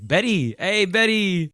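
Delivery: high, bright-eyed Hank calling Betty; NoHo Hank impression. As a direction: sing out Betty like a cheerful Chechen mobster. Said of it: "the way he says Betty".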